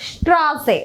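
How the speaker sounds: "സ്ട്രാസെ" as shtraa-seh, long "aa". Shown as a demonstration. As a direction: open "സ്ട്രാസെ" on sh, not s. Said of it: "ഒരു ഷാ sound കൊടുക്കണം". ("സ്ട്രാസെ" ഷ് ശബ്ദത്തിൽ)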